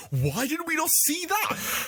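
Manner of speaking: high, indignant retold exclamation; retold outburst. Podcaster quoting their outburst at a deleted scene, high indignant exclamation. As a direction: exclaim it high, like a baffled complaint. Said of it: "Which I said".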